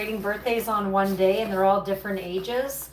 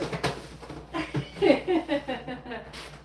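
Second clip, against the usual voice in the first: laugh; 4 semitones higher than usual. Real, not acted. Laughing at imagined accent.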